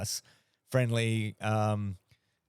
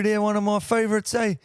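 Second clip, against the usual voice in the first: high, loud hammed-up New Zealand bro voice; mock Kiwi accent; 9 semitones higher, 5 dB louder than usual. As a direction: play a cheeky Kiwi mate. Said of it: "better than a haka".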